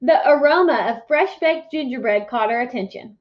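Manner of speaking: usual speaking voice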